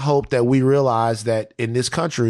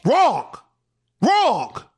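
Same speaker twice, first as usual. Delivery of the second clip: mock outrage; 11 semitones higher than usual. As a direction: scold them like a game-show buzzer. S0 mock-scolds co-host's answer.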